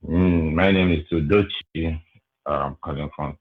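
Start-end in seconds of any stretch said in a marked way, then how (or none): none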